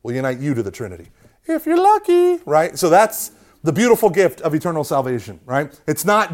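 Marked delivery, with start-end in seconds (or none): 1.4-2.4 high-pitched, teasing lilt; jokey aside. Pretend. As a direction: toss it in high and playful. Jokey high aside after teaching.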